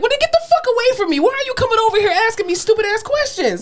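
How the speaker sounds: hammed-up fury at the worker; retold angry outburst. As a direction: blow up at him in a mock rage. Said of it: "And I was like".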